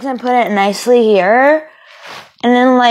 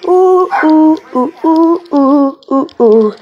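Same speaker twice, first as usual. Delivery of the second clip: higher, louder hooting howl that sinks each time; mock howling; 4 semitones higher, 4 dB louder, slower than usual. Alone with the dogs, a loud mock howl. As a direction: howl it out like a dog.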